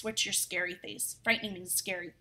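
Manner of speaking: plain reading voice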